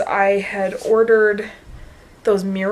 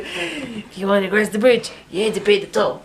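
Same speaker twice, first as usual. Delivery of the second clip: a dim troll demanding the toll. Retold or imitated. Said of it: "my troll voice".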